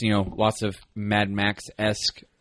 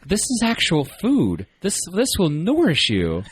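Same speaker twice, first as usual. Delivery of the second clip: high, slow pleading cartoon voice; Jerry; 8 semitones higher, slower than usual. Jerry reasoning with a wild dog, mock pleading. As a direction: plead slowly, pitched well up. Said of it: "and he's like".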